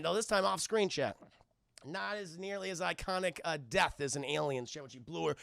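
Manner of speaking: ordinary talk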